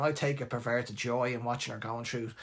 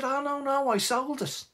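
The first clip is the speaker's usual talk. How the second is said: high, offhand dad voice, lightly mocking; imitating dad; 12 semitones higher than usual. Dad telling his son the car is gone, high and offhand. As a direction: toss it off like a smug father.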